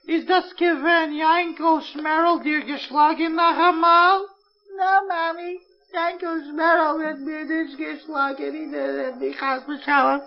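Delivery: high, slow, doting mother; mother soothing her little son; tender mother and child voices. Acted. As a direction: coax a crying boy gently. Mother and crying child dialogue.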